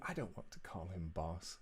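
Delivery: a proud hero refusing; sulky Gideon. Acted. Gideon sulks.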